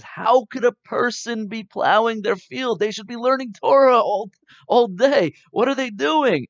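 high, loud outraged cry; indignant outburst. Acted. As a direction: shout it in shocked disbelief. Rabbi Shimon outraged at plowman.

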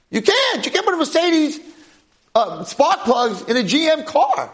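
high-pitched, insistent protest; exasperated objection. Acted. Teacher arguing down a foolish car owner, exasperated. Acted objection to his own idea.

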